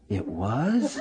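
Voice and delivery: small, hushed questioning voice; imitated surprised student. Student learning the book was Marxist, hushed mock surprise. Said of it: "Like that".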